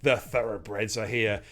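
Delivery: ordinary talk, nothing marked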